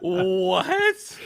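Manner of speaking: very high, loud squeal of disbelief; shrieked what; shocked shout. Real, not acted. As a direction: yelp it in total surprise. Shocked reaction amid laughter.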